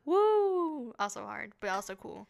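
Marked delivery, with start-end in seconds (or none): 0.0-0.9 high, loud drawn-out woo; whoop. Real, not acted. Reacting to Dominican Spanish.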